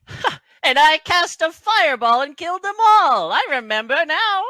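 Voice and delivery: high, very loud halfling boast; Dabbledob. Acted. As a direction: crow it out in a piping shout. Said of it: "Dabbledob speaks up".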